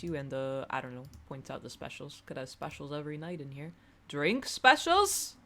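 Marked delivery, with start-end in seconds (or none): 4.1-5.4 slow, high, very loud disbelief; incredulous. Acted. Bar regular reacting to drink specials, loudly incredulous. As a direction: stretch the questions up high in mock outrage.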